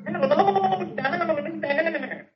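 distant, high, drawn-out pipe echo; voice from a sink. Acted. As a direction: make it sound like it travels up a drain. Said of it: "hears Beetle's voice come out of the sink".

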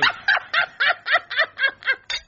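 very high, pulsing laugh; hysterical laughter. Acted. Debbie defying the order to stop laughing, hysterical cackle. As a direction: keep cackling helplessly despite the protest. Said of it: "Stop laughing!".

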